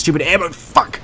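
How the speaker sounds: mock rage bursting out at the end; exasperated outburst. Gamer venting about the game's ammo, exasperated outburst. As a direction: rant that erupts into a comic curse. Comic rant ending in swearing.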